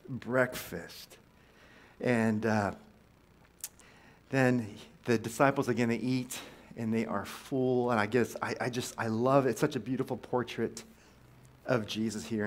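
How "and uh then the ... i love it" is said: ordinary talk, nothing marked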